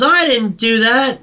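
high, whiny denial; nerdy Seymour denial. Retold or imitated. Seymour denying the killing to Mushkin, high and whiny. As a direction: squeak out a protest of innocence. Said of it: "And he goes".

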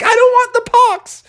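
very high, loud squeal; panicked cry. Acted. Guests running from the frog, high panicked cry.

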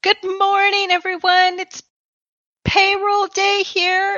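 high, beaming cheerful greeting, the last words drawn out slowly; a sunny receptionist calling good morning to the whole office; bright sunny voice. Acted. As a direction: chirp it like the office sweetheart. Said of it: "you'll hear a very bright, sunny voice go".

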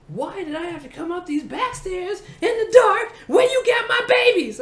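high, loud shouting mother; angry mother's high shout; mom shouting. Retold or imitated. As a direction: storm up the stairs yelling. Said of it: "she's like / She's screaming".

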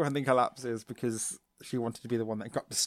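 ordinary talk

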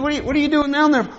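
quick surprised what-are-you-doing; incredulous question. Retold or imitated. Asking his preacher friend, fast and puzzled. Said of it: "I said".

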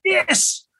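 high, ecstatic re-enacted cheer; cheering like footy fan. Watching the testimony at night, cheering like a footy fan. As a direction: shout it like your team just scored. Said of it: "jumping up and down like a footy game".

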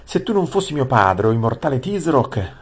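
high, loud seething rage; through gritted teeth. A narrator's reading. Prince insults his father as a coward, enraged. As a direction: grind out the insult at your father. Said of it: "digrignando i denti per la rabbia".

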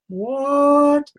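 high, astonished squeal; mock shocked teenager; teen amazement. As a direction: gasp like a stunned teenager. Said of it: "me being a teenager in the nineties going".

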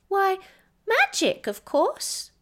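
put-on high, bright child explaining magic; a small girl's eager voice; little girl voice.

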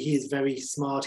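usual speaking voice

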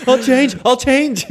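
high, loud mock panic; panicked pleading. Dad busts down door.

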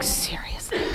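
hushed, incredulous aside; retold disbelief. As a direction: say it under your breath in disbelief. Retold disbelief at friend's advice.

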